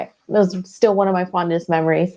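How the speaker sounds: ordinary delivery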